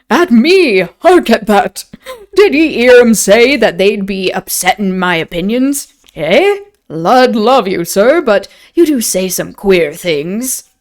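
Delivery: loud, incredulous rustic English innkeeper; booming country host scoffing at the idea; innkeeper helpless with amusement. A narrator's reading. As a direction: exclaim in hammed-up tavern dialect, hugely amused. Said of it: "laughed until his sides ached".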